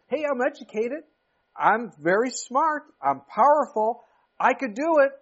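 strut through it like a cocky know-it-all; proud young Moses. Retold or imitated. Young Moses boasting he can deliver, loud and high. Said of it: "Before it was".

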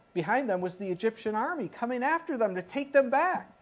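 ordinary talk

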